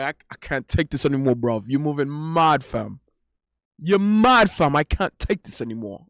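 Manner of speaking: imitated exasperated Brit at wits' end; mock-indignant British slang, falling hard at phrase ends; Shaquille's British accent. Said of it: "with his British accent".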